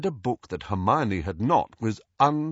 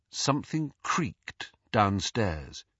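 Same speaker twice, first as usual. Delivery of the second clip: ordinary delivery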